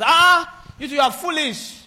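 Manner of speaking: high outraged "Ah", then thrown insult; mock shouting match. One quarreller insulting another back, mock-angry shouting. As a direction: act out a heated comeback. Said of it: "Somebody is shouting, and I'm shouting too".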